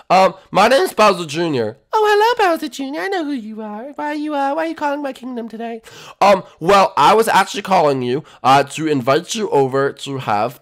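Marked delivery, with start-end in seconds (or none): 1.9-5.8 high, polite falsetto princess. Acted. Princess answering the residence phone, high and polite.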